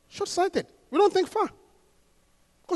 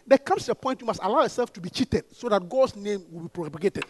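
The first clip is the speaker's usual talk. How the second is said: ordinary talk, nothing marked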